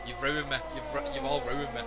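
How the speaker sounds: ordinary delivery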